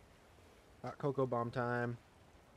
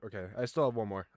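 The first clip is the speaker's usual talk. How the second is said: usual speaking voice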